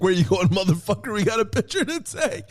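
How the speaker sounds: high, fast, sing-song grab-back; playful rocker bravado. Retold or imitated. Gene hauling the fan back for the photo, high and sing-song. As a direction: call him back like an old buddy.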